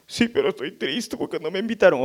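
a hint of a hurt child; sad daughter. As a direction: daughter telling dad she was left out, lightly voiced.

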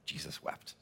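whispered Jesus wept; widow's whisper in a silent kitchen; hushed. Retold or imitated. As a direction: breathe the words softly, barely voiced. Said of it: "just looked up and said".

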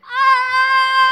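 loud, high, long scream; acted-out yelling. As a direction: scream it out full force, sustained. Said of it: "I had to act it out".

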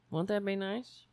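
usual speaking voice

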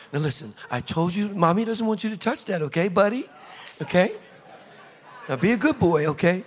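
gentle mommy talk, lilting up at the ends; coaxing mom. Acted. Mother forgiving little Jimmy, coaxing him to be good. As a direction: coax a little boy with rising questions.

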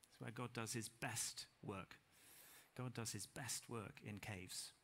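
a hushed weighty line said slowly; hushed emphasis. Real, not acted. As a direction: let it land quietly, slowly. Quiet emphatic sermon point.

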